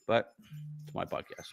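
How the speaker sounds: hushed whisper to the audience; whispered aside. Real, not acted. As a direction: whisper it as a sly justification. Cheeky aside after his rant.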